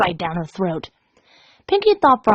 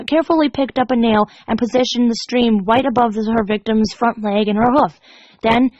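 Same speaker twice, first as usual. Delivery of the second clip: ordinary delivery, nothing marked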